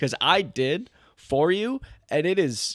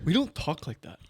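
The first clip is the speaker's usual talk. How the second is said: put-on East Coast accent; 5 dB quieter than usual. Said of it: "he said it with an East Coast accent".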